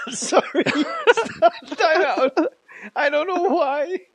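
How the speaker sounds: high, helpless laughing apology; can't stop laughing, trying to talk; laughing fit. Real, not acted. Co-host cracks up mid-story, laughing helplessly. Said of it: "For some reason, just hit me".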